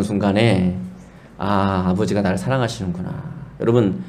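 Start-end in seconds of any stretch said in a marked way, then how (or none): 1.4-3.1 tender realization. Retold or imitated. Recalled realization of father's love.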